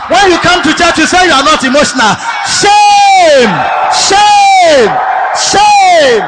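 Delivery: high, loud scolding into drawn-out cries; shouted preacher's rebuke. Real, not acted. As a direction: cry shame on the congregation. Rebukes congregation at full force.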